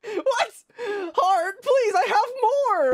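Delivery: high, slow whiny begging; mock whiny plea. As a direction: plead like a kid. Homework dismissed, pleads.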